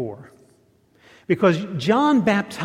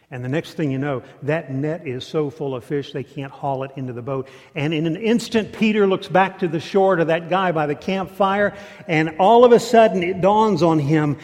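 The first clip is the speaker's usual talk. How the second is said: ordinary delivery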